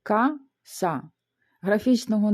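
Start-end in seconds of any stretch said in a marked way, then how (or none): 0.0-1.0 "Каса" split in two, pause between syllables. Shown as a demonstration. Teacher showing two syllables to learners, syllable by syllable. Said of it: "Тут у нас два склади".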